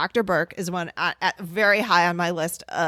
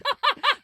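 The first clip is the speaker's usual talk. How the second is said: high giggle. Real, not acted. Laughs at her own voice.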